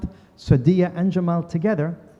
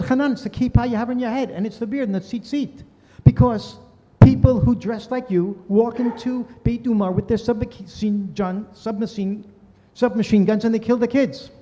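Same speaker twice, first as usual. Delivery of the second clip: higher, earnest voice of Jamal; quoting Jamal; 6 semitones higher than usual. Retold or imitated. Jamal explaining to Hanan why kids cry. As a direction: voice the friend pleading his point.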